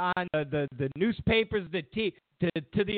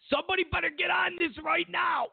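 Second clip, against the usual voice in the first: mock outraged shout; 12 semitones higher than usual. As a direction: bellow the order in mock panic. Ranting shout, heard shout.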